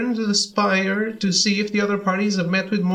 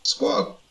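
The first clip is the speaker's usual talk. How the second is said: high falling bird squawk; 6 semitones higher than usual. Acted. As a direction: screech like an angry birdman. Birdman cries out.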